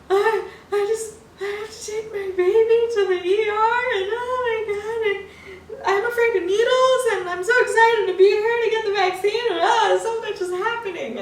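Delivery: high, loud sobbing babble in a mock breakdown. To the nurse before her vaccine, high, loud sobbing. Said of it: "broke down and started sobbing".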